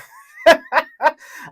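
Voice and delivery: high burst of laughter; laughing. Real, not acted. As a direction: laugh out loud. Laughing at nosy neighbor.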